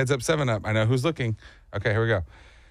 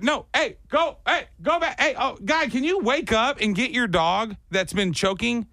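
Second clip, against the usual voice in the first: calling out; 7 semitones higher than usual. Retold or imitated. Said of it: "And then I'm like".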